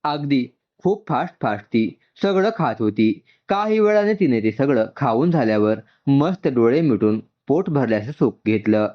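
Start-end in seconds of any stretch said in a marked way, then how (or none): none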